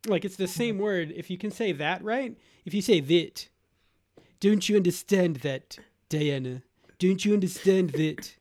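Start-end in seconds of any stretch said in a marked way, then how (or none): 4.4-8.3 British royal impersonation with squeezed vowels; Charles. Acted. Dad demonstrates the key word to Mario, British royal voice. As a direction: say "that" like "thet", as a pleading English royal. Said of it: "for doing Charles".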